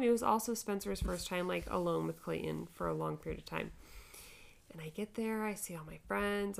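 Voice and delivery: ordinary talk, nothing marked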